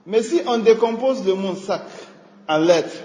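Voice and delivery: ordinary talk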